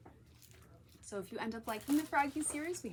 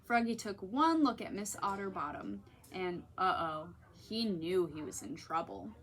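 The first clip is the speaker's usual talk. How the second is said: usual speaking voice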